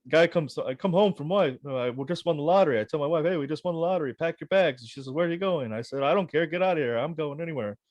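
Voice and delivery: lightly voiced old comic telling a joke; Rodney Dangerfield impression. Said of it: "he's like".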